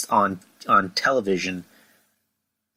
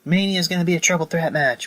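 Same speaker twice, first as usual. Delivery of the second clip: hammed-up boss announcing the match; mock Stephanie; 11 semitones higher than usual. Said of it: "Stephanie would be like".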